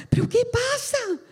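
retold startled cry, pitched well up; alarmed bewilderment. Preacher recalls asking mother-in-law what is happening, alarmed bewilderment. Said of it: "Y yo decía".

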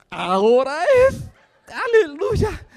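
drawn-out, high, loud burst of joy; elated cheer. Retold or imitated. Preacher reliving his hope as she walks over, elated. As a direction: celebrate it, climbing high.

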